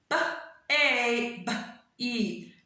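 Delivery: "B A B E" as four separate sounds; sounded out. Shown as a demonstration. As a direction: break baby into b, ay, b, ee with gaps. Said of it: "the next word I have is baby".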